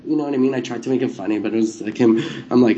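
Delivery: ordinary talk, nothing marked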